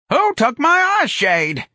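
put-on slow, very high, loud old-woman screech; fierce demand. A narrator's reading. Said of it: "Miss Trixie demanded fiercely".

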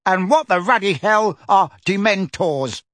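irate demand. A narrator's reading. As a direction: demand it with outraged emphasis. Uncle demands angrily.

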